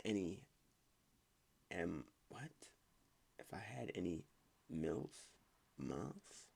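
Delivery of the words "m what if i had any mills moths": confused whisper to herself; puzzled muttering under breath; whispering to self. Real, not acted. Confused while reading the post.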